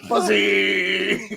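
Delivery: high, drawn-out mock intro call; imitating host's intro. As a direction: draw out "Fuzzy!" like a hype intro. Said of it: "you're like".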